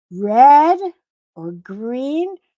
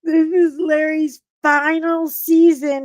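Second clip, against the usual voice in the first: high wailing cry over Larry; hammed-up mourning wail; mock sob, much higher; mock grief; 6 semitones higher, 5 dB louder, much slower than usual. Mourning Larry's final season.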